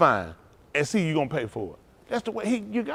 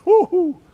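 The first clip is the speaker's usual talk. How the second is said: high, loud woo-hoo whoop; joyful whoop; 13 semitones higher, 8 dB louder than usual. Real, not acted. Preacher whooping to the congregation, loud and high. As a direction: let out a celebratory whoop. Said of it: "preacher whoops".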